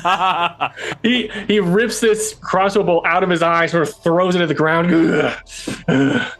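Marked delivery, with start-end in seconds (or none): 4.9-6.3 monster threat. Acted. Butcher threatens after eye wound.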